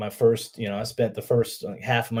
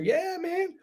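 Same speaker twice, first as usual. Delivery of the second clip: high-pitched mock-keen yes, softer; eager reply; 5 dB quieter than usual. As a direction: replay a naive recruit's delighted agreement. Acts out keen reply to recruiter.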